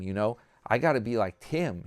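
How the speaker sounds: ordinary talk, nothing marked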